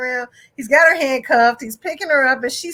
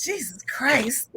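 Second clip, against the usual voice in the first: much softer, slow, fed-up sigh of words; exasperated mutter; 2 semitones lower, 8 dB quieter, slower than usual. Retold or imitated. Cop slams the car door and mutters, exasperated. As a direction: let it out under your breath, worn out.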